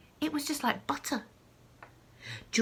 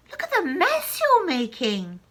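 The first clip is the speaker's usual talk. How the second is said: indignant call; 8 semitones higher, 7 dB louder than usual. Acted. George scolds Grandma.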